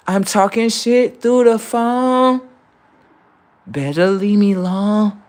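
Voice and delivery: slow, high distorted taunt; high slow warped taunt; mocking alien voice.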